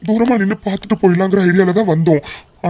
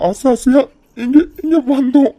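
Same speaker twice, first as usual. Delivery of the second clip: tearful; 4 semitones higher than usual. Acted. Mother hurt by rejection.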